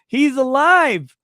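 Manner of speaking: high, loud, drawn-out cry; hammed-up jubilant shout; mock triumphant shout. Mock cheer at a funeral joke.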